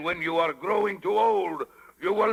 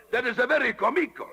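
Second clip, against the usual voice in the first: fast, higher, laughing through it; laughing scornfully; 6 semitones higher, faster than usual. Acted. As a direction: talk through a mocking laugh. Stromboli laughs at Pinocchio.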